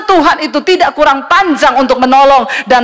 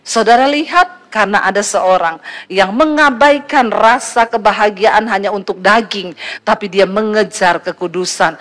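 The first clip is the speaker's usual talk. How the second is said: normal talking voice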